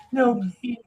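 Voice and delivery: high put-on little dog begging; pleading dog voice. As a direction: beg in a squeaky puppy voice.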